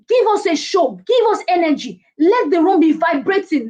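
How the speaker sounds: high-pitched, energetic rally cry; rousing demand. Shown as a demonstration. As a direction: fire up the room. Coach demanding energy from presenters.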